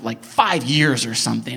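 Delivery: ordinary talk, nothing marked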